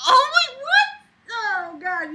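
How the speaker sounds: a hammed-up gasp of disbelief; mock shock. Host feigning shock at the narrator's confession.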